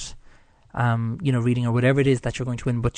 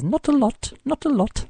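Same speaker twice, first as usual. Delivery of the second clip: high, quick English showman catchphrase; much higher imitation of the English magician; Paul Daniels impression; 13 semitones higher than usual. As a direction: mimic Paul Daniels teasing with an English accent. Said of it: "It was Paul Daniels".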